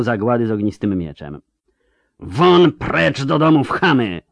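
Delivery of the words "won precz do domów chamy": slow, higher, forceful scream; furious yell. Acted. Yennefer whips the mob with fire, yelling in fury. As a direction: spit out each word at the rabble. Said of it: "wrzasnęła".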